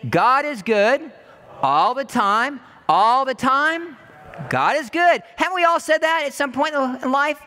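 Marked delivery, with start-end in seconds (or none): 0.1-5.2 slow, loud, said together; slow loud preacher's response; congregation response. Acted. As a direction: lead the room in saying it. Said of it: "Can you say it with me?".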